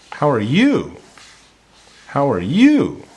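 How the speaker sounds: YOU swoops high, then falls; question intonation. Shown as a demonstration. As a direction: lift your voice sharply on "you". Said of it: "a little bit more intonation, a little more voice change at the end".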